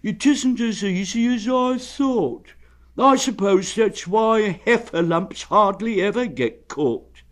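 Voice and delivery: slow, mournful sigh; sad. Acted. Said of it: "added sadly".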